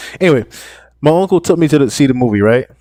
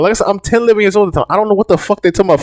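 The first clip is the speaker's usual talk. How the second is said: ordinary talk, nothing marked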